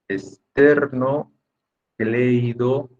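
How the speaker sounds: "Esternocleido" drawn out very slowly, part by part; teacher slowly breaking up "Esternocleido"; broken into parts. Shown as a demonstration. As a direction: sound out "Esternocleido" in slow, separate chunks. Teacher breaks long word into parts.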